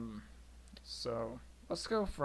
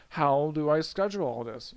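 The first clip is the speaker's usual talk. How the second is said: loud, raised-pitch mock panic; overwhelmed outburst; 2 semitones higher, 10 dB louder than usual. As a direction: blurt it out like an overloaded student. Acted overwhelmed student with too much.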